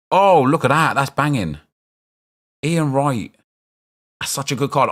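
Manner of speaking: high, loud thrilled reaction; light up at the card reveal; high and loud, genuinely delighted; excited. Real, not acted. Real pack reveal excitement.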